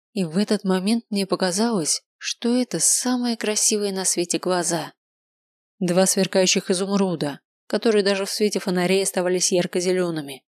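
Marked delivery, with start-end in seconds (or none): none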